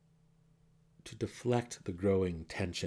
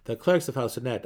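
ordinary delivery

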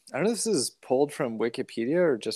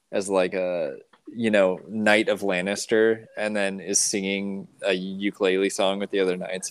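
normal talking voice

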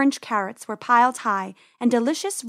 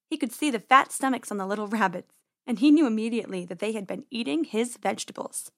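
ordinary delivery, nothing marked

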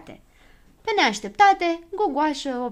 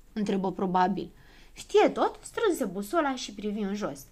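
plain reading voice

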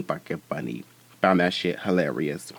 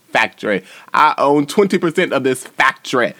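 playful Southern mimicry, pitched up; imitated Southern accent; 5 semitones higher, 5 dB louder than usual. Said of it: "another one, she was like".

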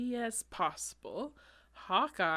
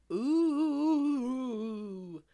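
ghost voice; 3 semitones higher, 8 dB louder, much slower than usual. Acted.

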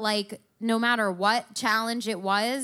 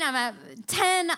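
normal talking voice

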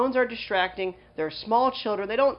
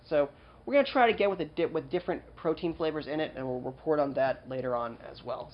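ordinary delivery

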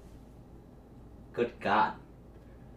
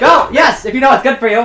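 high loud laughing yell; laughing shout; 8 semitones higher, 11 dB louder than usual. Acted. Ordering partner, laughing.

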